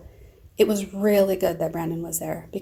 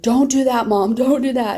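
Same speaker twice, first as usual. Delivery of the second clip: loud, higher begging; urgent plea; 5 semitones higher, 11 dB louder than usual. Retold or imitated. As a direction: cry out to stop her. Daughter urgently pleading with mom.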